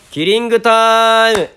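high, loud, slow title call. Acted. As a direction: belt out the show's catchphrase. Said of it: "番組タイトルコール". (高く大きくゆっくりタイトルコール)

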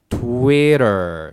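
TWITTER drawn out slowly, "twweh-derr"; slowed English pronunciation. Shown as a demonstration. Teacher exaggerating for learners, slow American pronunciation. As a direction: stretch TWITTER with puckered W and long final R. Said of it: "最後舌を引いてる".

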